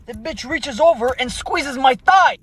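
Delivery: ordinary talk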